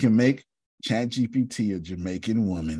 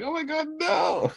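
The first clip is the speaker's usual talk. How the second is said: mock shock. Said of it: "She's like".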